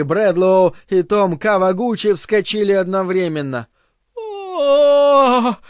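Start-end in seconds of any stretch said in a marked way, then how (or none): 4.2-5.6 much higher ecstatic wordless cry; inarticulate cry. Acted. Joanna exclaims wordlessly in religious ecstasy. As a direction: let out a rapt wordless cry. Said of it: "нечленораздельно возгласила Джоанна".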